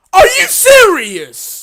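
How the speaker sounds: high, loud, drawn-out complaint; indignant protest. Real, not acted. Gamer protesting an unfair death, indignant cry. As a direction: wail it at the game.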